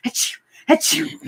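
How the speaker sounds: breathy mock sneezing; hammed-up pretend sneezes; fake sneeze. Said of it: "just fake sneeze noises".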